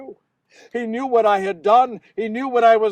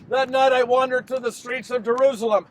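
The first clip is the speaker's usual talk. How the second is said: ordinary delivery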